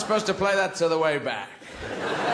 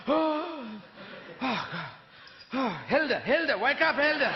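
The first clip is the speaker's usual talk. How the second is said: frantic husband; 6 semitones higher than usual. Acted. Waking wife Hilda.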